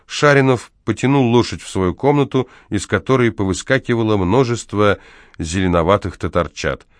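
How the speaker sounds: usual speaking voice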